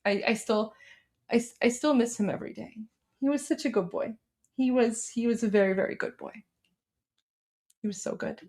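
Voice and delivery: soft, halting, fading to a near whisper; real emotional. Real, not acted. Owner missing her late dog every day, quietly emotional. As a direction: grieve softly, voice sinking away. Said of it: "not much gets me emotional".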